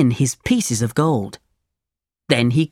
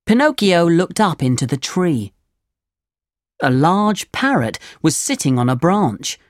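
ordinary delivery, nothing marked

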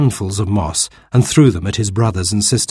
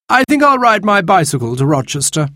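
put-on young man's voice; 10 semitones higher than usual. Baby decides.

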